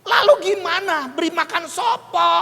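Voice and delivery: high, loud mock protest; exasperated retort. Preacher asks God whom to feed, exasperated.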